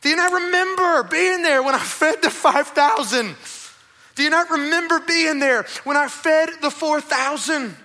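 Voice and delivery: loud, high, exasperated questioning; Jesus' exasperated rebuke. Acted. Jesus to his disciples, a loud exasperated rebuke. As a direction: hammer the question home as Jesus.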